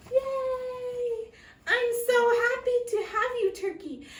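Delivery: high, fast gushing welcome; delighted welcome. Acted. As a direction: gush with joy. Missus Moose welcomes turkey.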